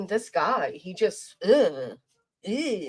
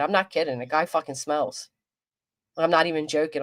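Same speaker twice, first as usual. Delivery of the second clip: ordinary talk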